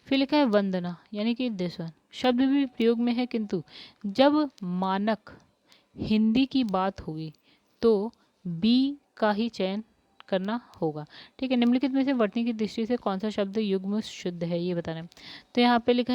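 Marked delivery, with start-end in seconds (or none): 4.0-11.0 slow emphasis. Shown as a demonstration. Teacher stressing the standard rule.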